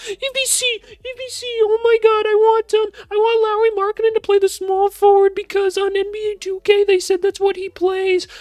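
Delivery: very high-pitched, loud mocking fan voice; loud squeaky caricature of a complaining fan; A V C voice banter. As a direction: squeal it like an overexcited, clueless fan. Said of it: "my A V C voice banter".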